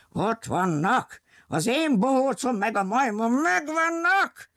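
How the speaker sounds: villain's triumphant cry. Acted. Circus owner spots his runaway clown and monkey, excited high cry. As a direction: crow over finding them at last.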